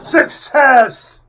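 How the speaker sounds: very high, loud victory yell; triumphant shout. Real, not acted. Triumph after finally drilling through.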